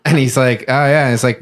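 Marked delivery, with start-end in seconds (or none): 0.7-1.0 put-on drunk voice. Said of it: "like, in a drunk voice".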